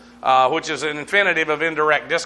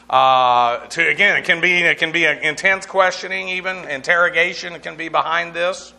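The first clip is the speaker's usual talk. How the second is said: ordinary talk, nothing marked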